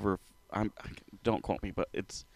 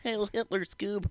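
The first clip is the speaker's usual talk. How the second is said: high, cartoonish Shaggy squeak; goofy stoner cartoon voice, pitched way up; 12 semitones higher than usual. Shaggy voice joke.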